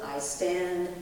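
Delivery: usual speaking voice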